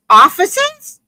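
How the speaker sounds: high rising startled "Officers?"; alarmed question. Retold or imitated. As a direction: caught off guard by the police. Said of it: "And she goes".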